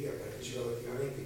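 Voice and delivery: ordinary talk, nothing marked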